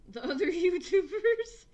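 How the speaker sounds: cracking up at your own scheme; laughing. Real, not acted. Gamer giggling to chat about trolling other YouTubers, laughing.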